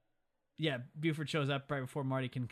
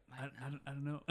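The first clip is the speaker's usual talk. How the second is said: soft, stumbling confused reply; puzzled murmur; 9 dB quieter than usual. Retold or imitated. The gang looking at each other, quiet mock confusion. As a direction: mumble it, imitating baffled henchmen.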